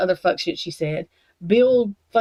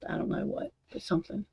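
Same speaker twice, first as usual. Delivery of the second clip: muttered aside; 10 dB quieter, faster than usual. Real, not acted. Muttering aside while ranting.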